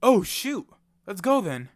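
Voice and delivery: loud startled exclamation; alarmed. Acted. Player alarmed on learning bombs are about to drop. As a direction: react fast to the danger.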